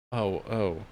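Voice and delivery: ordinary talk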